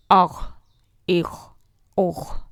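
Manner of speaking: اخ with a heavy throaty rasp; heavy gh drill. Shown as a demonstration. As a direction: practise the throat letter heavy. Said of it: "practice کرتے ہیں". (غین کی موٹی آواز کی مشق)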